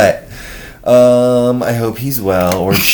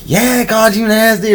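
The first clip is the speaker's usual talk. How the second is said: much higher mocking retort; sassy retort; 10 semitones higher than usual. Friend recalled taunting the stranger, mock sassy. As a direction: toss the insult back cheekily. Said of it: "It was something like".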